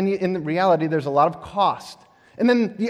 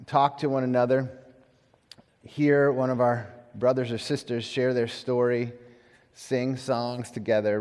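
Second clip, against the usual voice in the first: prayer; 8 semitones lower, 7 dB quieter than usual. Real, not acted. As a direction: pray gently, with long pauses. Leads a prayer.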